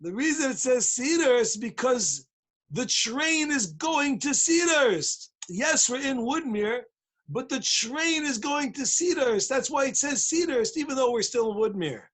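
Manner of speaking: mock-impatient, stretched-out lecturing; exasperated explaining. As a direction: spell it out for someone hopelessly dim. Exasperated Litvak explains to stupid chassid.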